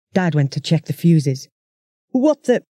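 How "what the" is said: Dad caught off guard, pitch jumping higher; startled half-question that breaks off; surprised. Acted. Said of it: "He sounded so surprised".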